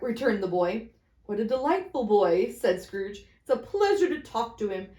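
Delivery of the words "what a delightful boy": plain reading voice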